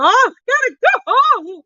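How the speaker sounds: very high put-on voice; hammed-up high voice wanting to go home; mock whiny quitter. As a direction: squeak it like a worn-out worker bailing. Imagined self quitting in massage chair.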